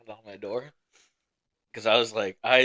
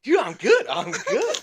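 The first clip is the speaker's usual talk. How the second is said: put-on much higher, louder drunk buddy; loud drunk insistence; 13 semitones higher, 8 dB louder, slower than usual. Drunk sergeant brushing off his friend, loudly insistent. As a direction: imitate a drunk protesting too much. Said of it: "being loud and belligerent as fuck".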